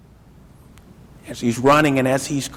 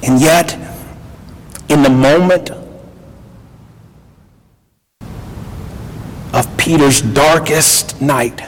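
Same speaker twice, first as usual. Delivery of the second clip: full-force preaching; 3 semitones lower, 8 dB louder than usual. Acted. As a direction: build it like a pulpit climax. Preaching climax on hope.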